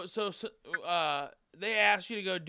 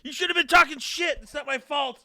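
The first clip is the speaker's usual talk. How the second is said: higher, louder mock whine of a defensive excuse; 10 semitones higher, 8 dB louder than usual. Friend mocks his dwelling as an excuse, high and loud.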